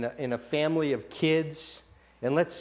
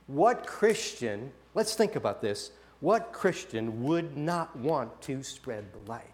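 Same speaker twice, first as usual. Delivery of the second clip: slow pointed question; 3 semitones lower, 4 dB quieter, slower than usual. Acted.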